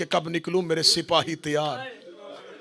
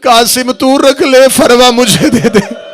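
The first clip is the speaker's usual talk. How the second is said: majlis recitation building; 8 dB louder than usual. Acted. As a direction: build the grief name by name until it cries out. Majlis recitation rising in grief. (मजलिस का मर्सिया अंदाज़, धीरे से शुरू होकर ऊँचा होता हुआ)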